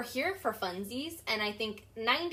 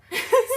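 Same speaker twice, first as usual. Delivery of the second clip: bright laugh; 14 semitones higher, 11 dB louder than usual. Real, not acted. Laughs after her point.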